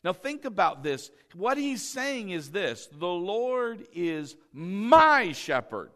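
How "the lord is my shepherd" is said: MY drawn out long and loud; slow line, leaning hard on "my"; stress on MY. Shown as a demonstration. Preacher explaining what the psalmist is saying, heavy stress on MY. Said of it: "He's not even thinking about someone else".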